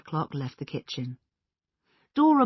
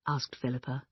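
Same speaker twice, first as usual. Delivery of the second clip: usual speaking voice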